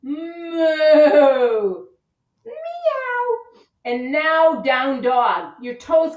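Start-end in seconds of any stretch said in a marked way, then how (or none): 0.0-1.9 loud full moo; big cow moo. Pretend. Teacher mooing big with kids. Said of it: "the whole world can hear you". 2.4-3.4 cat meow. Pretend. Teacher meowing in cat pose for kids. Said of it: "the whole world can hear you".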